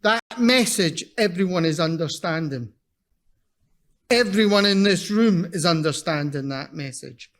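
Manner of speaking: ordinary talk, nothing marked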